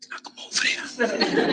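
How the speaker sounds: very soft, voiceless mock whisper; whispered beckoning. Inmates signalling on the rec yard, whispered beckoning. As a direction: summon someone across the yard in secret.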